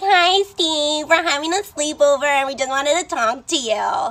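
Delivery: high, drawn-out group greeting to a crush. Retold or imitated. Friends voiced greeting boy on webcam.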